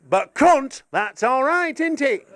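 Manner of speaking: high, lilting mock Northern English; put-on Northern English accent. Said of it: "I wouldn't say it in my own accent".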